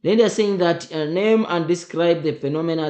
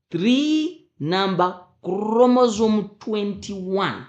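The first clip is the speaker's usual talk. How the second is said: "three" punched higher and louder, words slowed; stress on three; 7 semitones higher, 5 dB louder, slower than usual. Shown as a demonstration. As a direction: hit the word "three" hard and slowly. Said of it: "they don't say three".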